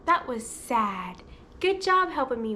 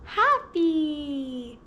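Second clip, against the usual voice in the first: drawn-out, beaming 'happy'; acted happy; 3 dB louder than usual. Shown as a demonstration. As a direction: say it through a big smile. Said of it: "Now let's act happy".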